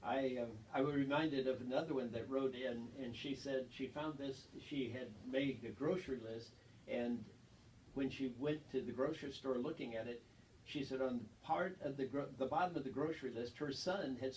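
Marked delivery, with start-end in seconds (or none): none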